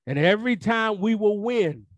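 high ringing preacher's cry; preaching at full force. Acted. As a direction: lift the congregation up high. Preacher peaks.

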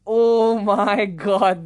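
very slow, drawn-out amazement; hammed-up starstruck wonder; awestruck. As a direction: savour each word in mock awe. Basking in being treated as celebrity.

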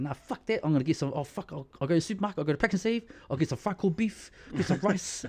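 high, fast insistent plan; determined uncle. Retold or imitated. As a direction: talk over him with gusto. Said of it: "He's like".

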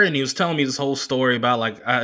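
usual speaking voice

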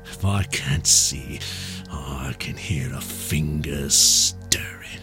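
low, slow sinister whisper; menacing whisper. Acted. Blind man whispers a warning to the captain. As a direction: breathe the threat at him.

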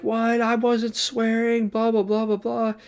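very high-pitched imitation of outraged denial; put-on high, drawn-out indignant voice; mock pissed-off protest. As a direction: mimic an angry client whining in protest, far above your own pitch. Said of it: "he was so pissed".

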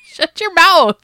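high, loud mock outrage; playful outraged retort. Real, not acted. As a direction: yell it as a joking comeback. Playful comeback to teasing.

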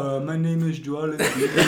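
slow, lightly put-on impression of a Cameroonian player; Joel Embiid. Friends ask for a little Joel, slow player impression. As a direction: do a slow mock athlete introduction. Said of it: "Give us a little Joel".

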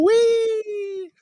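very high squealed wee; mock wee. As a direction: squeal like a kid on a swing. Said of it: "Bitch swinging from my nuts saying".

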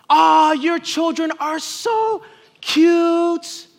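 high, slow and sugary; gushing admirer. Retold or imitated. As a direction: coo it like a doting church lady. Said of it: "receive comments like this".

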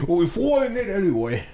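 high, hammy Cockney voice; squeaky put-on Cockney; Cockney accent. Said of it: "do a Cockney accent".

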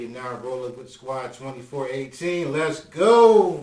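podcast host opener; show intro. Acted. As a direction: hype up the show open.

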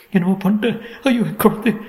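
high, panicked mother's cry; tearful wail. A narrator's reading. Mother crying for her baby, high tearful wail. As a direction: wail in distress, pitch way up. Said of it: "Ahalya அழுகையை நிறுத்தவில்லை".

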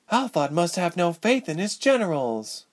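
high, mocking heroine voice; sarcastic. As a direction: needle him in a high voice. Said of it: "Ilse asked sarcastically".

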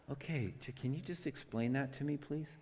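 hushed, softly spoken request; soft gentle answer. Acted. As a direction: drop your volume and ask gently. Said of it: "you begin to talk softly".

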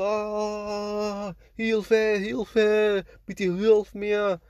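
high, drawn-out wailed plea; mock screaming for help. Burning woman runs at the dreamer, imitated high screaming. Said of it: "schreiend auf mich zu".

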